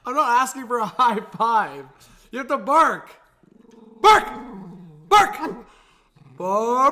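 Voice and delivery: very high, loud, laughing commands to bark; squealing at the dog to bark; laughing dog coaxing. Real, not acted. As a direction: beg the puppy to bark, cracking up.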